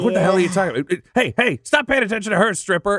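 high, indignant protest; whiny doctor. Acted. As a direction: demand the attention back. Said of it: "He's like".

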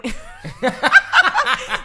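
big high burst of laughter; a loud, high giggle. Real, not acted. Laughs before answering.

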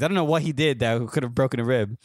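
normal talking voice

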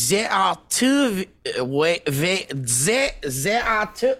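mock French, feeling for the words; French accent. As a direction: fumble into a put-on French accent. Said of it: "Can you do it in a French accent?".

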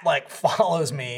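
normal talking voice

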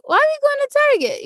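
high-pitched incredulous question; baffled disbelief. Retold or imitated. Kid questioning the odd field trip, baffled. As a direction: retell it as a thoroughly puzzled kid.

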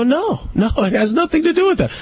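high-pitched retold objection, rattled off; indignant protest. Man retelling his denial to a critical woman, indignant. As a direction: push back in a high, insistent voice.

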